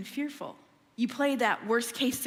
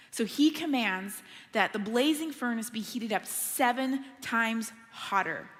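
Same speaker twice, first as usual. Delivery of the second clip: normal talking voice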